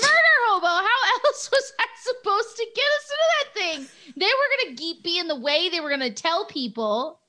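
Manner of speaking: high-pitched, laughing indignation; indignant protest. Real, not acted. Player protests being called murder hobo.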